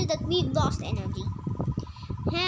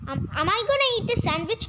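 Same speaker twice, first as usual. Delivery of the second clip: usual speaking voice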